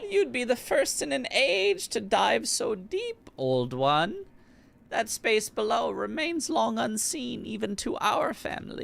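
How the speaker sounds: put-on high old granny voice; grandma voice. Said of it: "Love my grandma voice".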